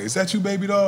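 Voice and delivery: a hint of an old lady squinting at her grandson; sweet grandma. Grandma greeting grandson through sore eyes, lightly voiced. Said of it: "she's like".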